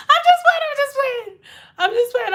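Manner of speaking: very high-pitched playful plea; squeaky backtrack. Pretend. Streamer taking back a joke on a viewer, squeaky backtrack.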